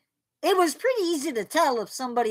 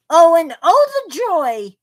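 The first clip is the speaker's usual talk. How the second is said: sarcastic; 4 semitones higher, 8 dB louder than usual. Real, not acted. Sarcastic about thunderstorm weather.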